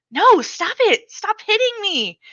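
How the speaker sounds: high panicked pleading, slowing; desperate plea. Acted. Little brother begging his sister to stop hitting him. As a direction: beg her to stop hitting.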